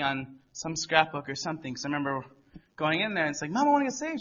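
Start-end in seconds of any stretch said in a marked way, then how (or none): 3.5-4.2 put-on high, eager kid's plea; eager little boy. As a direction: pipe up like an excited young boy. Child asking mom to be saved.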